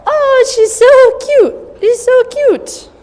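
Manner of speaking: very high, loud, slow cooing praise; high pitch praise. Real, not acted. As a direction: coo at the puppy way up high. Cooing at puppy.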